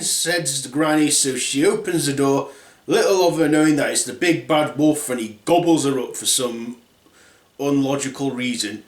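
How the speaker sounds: ordinary delivery, nothing marked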